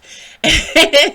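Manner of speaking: high, loud burst of laughter. Real, not acted. Joking about her many personalities, a loud real laugh. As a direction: burst out laughing at your own joke.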